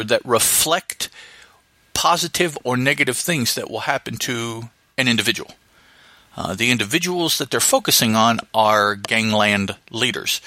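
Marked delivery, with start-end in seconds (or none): none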